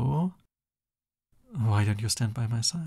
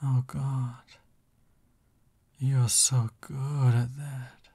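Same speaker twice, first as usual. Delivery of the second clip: swooning admiration; much slower than usual. Acted. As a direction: melt a little as you say it. Swooning at partner's villain act.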